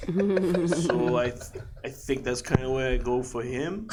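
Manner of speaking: put-on Brooklyn mama's boy, still in character; Brooklyn accent. Performer explaining a character, Brooklyn accent. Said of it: "he was from Brooklyn".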